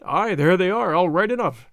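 usual speaking voice

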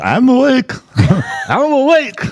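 shouting I'm awake. Retold or imitated. Patient shouting up at the doctor that he's awake. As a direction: yell it so the doctor finally hears.

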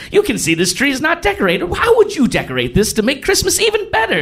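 high, hammed-up mayor of Whoville voice; high, hammy children's-show host coaxing a kid; mayor improv voice. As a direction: play it like a cheesy party host. Mayor improvising with Cindy Lou.

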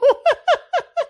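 a loud, very high burst of laughter. Real, not acted. Cracking up about the ten thousand price, very high. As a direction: crack up at yourself. Laughing at her own joke.

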